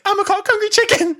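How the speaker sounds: very high, loud squeal all the way through; hammed-up squeaky confession; squealing mock. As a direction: shriek it like a silly cartoon hen. Rose repeats the demanded line.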